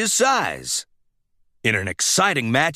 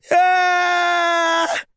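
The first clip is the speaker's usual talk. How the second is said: scared scream; 13 semitones higher than usual. Acted. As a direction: scream as the monster charges. Lightning sees the monster.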